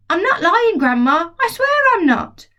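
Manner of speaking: put-on high, earnest boy's protest; pleading boy. George pleading with Grandma to believe him. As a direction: swear it like a desperate kid. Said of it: "George protests to Grandma".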